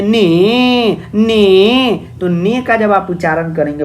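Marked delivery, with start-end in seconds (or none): 0.0-2.0 ने said alone, higher, the vowel held clear; sounding out ne. Shown as a demonstration. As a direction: isolate ने and let the e ring. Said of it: "जब आप ने का उच्चारण करेंगे".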